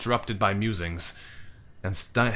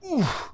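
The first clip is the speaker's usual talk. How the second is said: sharp high oof as the air is knocked out; sudden high winded huff. A narrator's reading. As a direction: huff out a breath as a body lands on you. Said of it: "I huffed".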